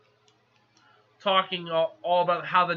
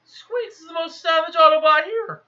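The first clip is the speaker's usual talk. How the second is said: higher, louder excited praise; 8 semitones higher, 4 dB louder than usual. Real, not acted. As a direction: gush about him.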